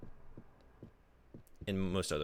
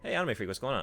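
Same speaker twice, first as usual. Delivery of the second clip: ordinary delivery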